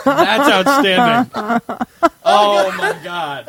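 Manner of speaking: laughing. Real, not acted. Slow laughing delight.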